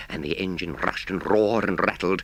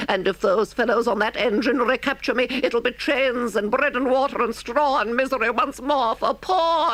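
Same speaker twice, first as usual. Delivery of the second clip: pleading Toad; 13 semitones higher than usual. Acted. Toad on his knees begging the engine driver to save him. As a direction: beg the driver in a high squeaky plea.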